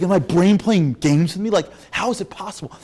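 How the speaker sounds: high, loud incredulous questioning; bewildered protest. Retold or imitated. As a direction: cry out in exaggerated bafflement. Player bewildered by his own brain.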